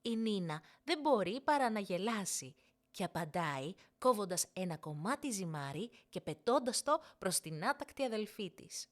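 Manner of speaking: ordinary delivery, nothing marked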